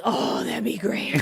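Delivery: breathy whispered eager relief; whispered agreement. Retold or imitated. Rachel agrees to Phoebe taking the blame, whispered. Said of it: "Rachel agrees".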